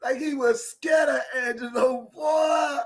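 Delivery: normal talking voice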